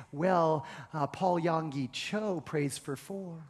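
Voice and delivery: soft, slow, sneering inner voice; accusing little voice. Retold or imitated. Pastor voices the condemning inner voice, soft sneer. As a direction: murmur it like a nagging conscience. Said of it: "a little voice that says".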